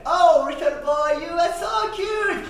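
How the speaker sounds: high cooing adult voice; doting grown-up. Retold or imitated. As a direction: coo over the boy's head. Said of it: "someone said to a boy".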